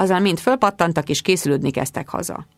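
ordinary delivery, nothing marked

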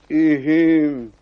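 closed-mouth grunt of assent; grumbled mumble. Acted. Cobbler confirming his boast to the devil, mumbling. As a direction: mumble a noncommittal yes. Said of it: "dünnyögte a szőcs".

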